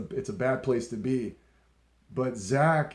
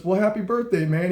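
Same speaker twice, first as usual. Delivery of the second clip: higher, louder imitated well-wishing; retold hearty congratulation; 6 semitones higher, 5 dB louder than usual. Guitar idol congratulates a fan, retold heartily. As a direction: reenact a hearty birthday wish. Retold idol's birthday wish.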